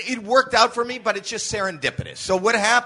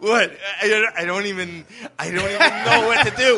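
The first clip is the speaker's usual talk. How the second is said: loud, laughing, baffled stage voice; trademark bewildered bit; 3 semitones higher, 5 dB louder than usual. Retold or imitated. Young comic doing his trademark bit for the crowd, baffled and laughing. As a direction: play the lost, giggly newcomer. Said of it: "my kind of a trademark".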